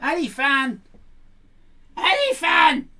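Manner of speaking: loud calling across the house. Real, not acted. Looking for Alifan, calling out loudly. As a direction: shout the name to find him.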